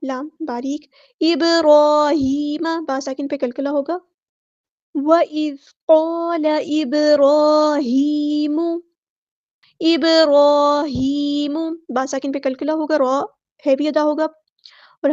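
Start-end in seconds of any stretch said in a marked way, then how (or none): 1.2-2.6 slow, drawn-out chanted recitation; tajweed chant; Quranic recitation. Shown as a demonstration. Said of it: "recites Quran verse in tajweed". 4.9-11.6 slow, drawn-out chanted recitation; tajweed chant; Quranic recitation. Shown as a demonstration. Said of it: "recites Quran verse in tajweed".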